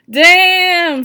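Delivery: very loud, high drawn-out Damn; loud impressed damn. A narrator's reading. Brother impressed by the boyfriend, loud admiring. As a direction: blurt out admiration.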